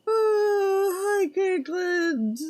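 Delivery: high, drawn-out delighted cry; excited greeting. Real, not acted. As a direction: squeal hello in high delight. Greeting a viewer, crying out.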